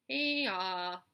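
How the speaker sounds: loud long bleat; lambs bleating. Acted. The lambs answer the roll, loud bleat. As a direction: bray it out together. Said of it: "answered the lambs all together".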